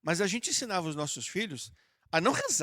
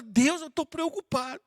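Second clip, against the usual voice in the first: higher, louder anxious plea; a mock worried cry to God; worried prayer; 8 semitones higher, 6 dB louder than usual. Shown as a demonstration. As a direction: sound troubled, asking for help. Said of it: "Se você tiver preocupado, fala".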